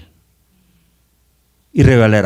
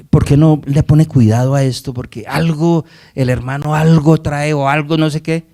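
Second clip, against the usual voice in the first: higher, insistent acted complaint; urgent warning; 4 semitones higher, 4 dB louder than usual. Church member urging the pastor to watch a brother, insistent. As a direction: press the suspicion, voice lifted and running on.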